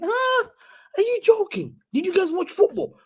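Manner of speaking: high-pitched scoffing disbelief; squeaky-high outraged questioning; incredulous. Real, not acted. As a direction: question them as if it's absurd. Scoffing at greatest keeper claim.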